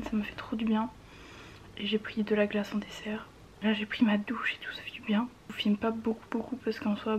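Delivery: soft whisper, holding ice packs. Real, not acted. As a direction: whisper it wearily.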